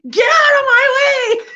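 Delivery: high, loud mock yell with a laughing cry; yelled get out of my way. After bumping into Gary, a loud mock yell. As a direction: bark it like you just bounced off a brick wall. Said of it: "and you're like".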